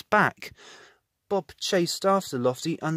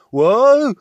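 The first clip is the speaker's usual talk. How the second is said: loud, higher whoa; startled whoa; 9 semitones higher, 14 dB louder than usual. A narrator's reading. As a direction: cry out as the napkin flies. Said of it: "cried Bob".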